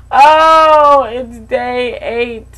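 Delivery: gasp, then a slow dragging groan of a line; dismayed gasp. Real, not acted. Dismayed at her own bald head on day eight. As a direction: drag the words out in dismay.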